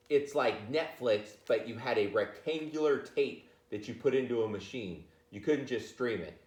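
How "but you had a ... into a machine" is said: ordinary delivery, nothing marked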